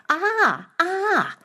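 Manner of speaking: high, sudden cry of alarm; startled cry. Acted. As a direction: jolt awake crying out high. Princess wakes from a dream.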